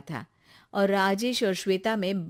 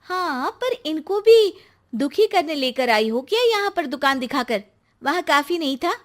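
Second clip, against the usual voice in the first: higher, louder, indignant wife; upset accusation; 6 semitones higher, 7 dB louder than usual. Acted. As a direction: lash out at your mother-in-law, hurt and louder. Hurt wife accuses mother-in-law. (दुखी होकर ऊँची आवाज़ में उलाहना)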